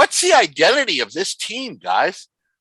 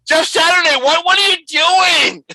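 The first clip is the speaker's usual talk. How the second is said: exasperated shout; 12 semitones higher, 5 dB louder than usual. Real, not acted. Exasperated call-out.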